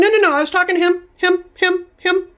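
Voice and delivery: high, loud and fast, flustered. Retold or imitated. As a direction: rush to explain, flustered and loud. Flustered correction to hostess.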